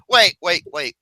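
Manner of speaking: usual speaking voice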